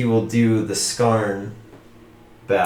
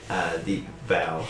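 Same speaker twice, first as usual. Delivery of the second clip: normal talking voice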